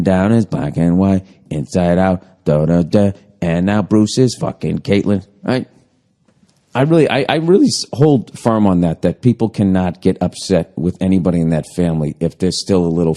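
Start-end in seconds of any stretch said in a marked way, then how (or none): none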